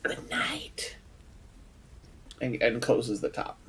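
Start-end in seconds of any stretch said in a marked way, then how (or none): 0.0-0.9 hushed, voiceless whisper. Acted. Player's character to the egg before closing the top, whispered. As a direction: whisper it very softly. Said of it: "he whispers".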